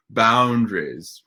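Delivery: slow, drawn-out, loud boundaries; mock earnest therapist inflection. Shown as a demonstration. Presenter mocks the therapist way of saying it. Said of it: "it's my, my, my therapist voice".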